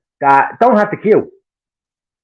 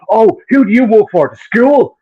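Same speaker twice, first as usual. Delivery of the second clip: higher-pitched, snooty retold challenge; mocking challenge; 6 semitones higher, 4 dB louder, slower than usual. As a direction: imitate an indignant driver questioning authority. Driver challenges lollipop lady, retold.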